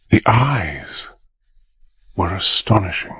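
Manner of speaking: narrator's voice lingering and fading on the eyes; awed narration. A narrator's reading. Hannay narrating her beauty to the listener, rapt storytelling. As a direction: savour each word of wonder.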